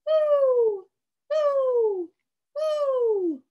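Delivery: high, falling hoots; owl hooting. Pretend. Reader plays owl for the children, hooting. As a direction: hoot long and evenly. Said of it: "Hoot like an owl".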